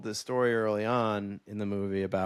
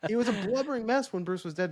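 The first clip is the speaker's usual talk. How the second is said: ordinary talk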